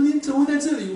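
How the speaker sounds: high and loud, amazed; surprised exclamation. Retold or imitated. Classmate amazed to meet pastor at restaurant, high surprised cry. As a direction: blurt it out, can't believe it's him.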